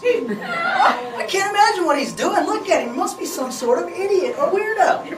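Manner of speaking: high, giggling mockery; laughing clerk. Clerk laughing to Allison about the weirdo outside, giggling.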